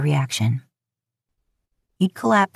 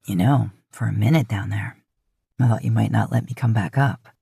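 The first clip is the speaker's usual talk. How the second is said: low teasing man's voice; 7 semitones lower than usual. A narrator's reading. Man teases his lover in bed, low and playful. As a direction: drop low and tease her. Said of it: "he teased".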